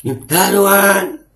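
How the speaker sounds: a slow, high, loud "Ya Tuhan"; shouted prayer. Shown as a demonstration. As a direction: bellow "Ya Tuhan" at the sky like a command. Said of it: "duar langsung ke sambar petir".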